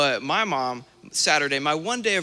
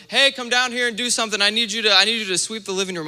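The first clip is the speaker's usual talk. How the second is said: high, loud, fast call from downstairs; mom yelling; 8 semitones higher, 5 dB louder, faster than usual. Retold or imitated. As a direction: shout up like an impatient mom.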